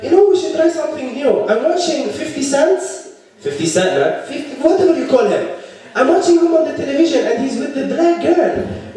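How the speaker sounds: high-pitched, excitable foreign cousin; eager immigrant cousin. Retold or imitated. Cousin urging him to try dating someone new, eager high voice. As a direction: play a keen cousin pitched well up.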